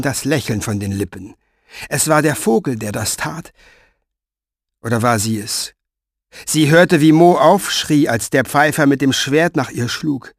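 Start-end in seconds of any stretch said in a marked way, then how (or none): none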